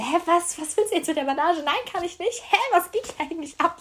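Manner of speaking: high-pitched incredulous questioning; flustered, indignant confusion; acted-out bewilderment; baffled protest. Baffled retort about the bandage.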